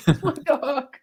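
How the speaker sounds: cracking up mid-impression; laughing mock voice. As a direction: try to hold the voice while laughing. Repeating the impression.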